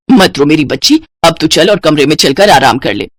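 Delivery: low, comforting motherly voice; soothing mother. Acted. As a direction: console a crying daughter. Mother comforts her crying daughter.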